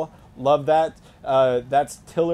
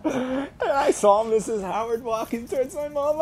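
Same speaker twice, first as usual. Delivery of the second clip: telling it through high laughter; can't stop laughing mid-story; laughing narration; 12 semitones higher than usual. Real, not acted. Laughing at his own cheating story, high giggly voice.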